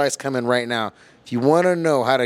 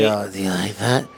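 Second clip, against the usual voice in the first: mock sexy talk; 4 semitones lower, 3 dB quieter than usual. Shown as a demonstration. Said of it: "you're gonna start talking like this".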